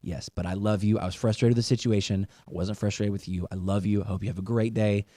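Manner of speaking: soft, low loving words; a dad quietly comforting his daughter; tender reassurance. Retold or imitated. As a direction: reassure her gently that you love her.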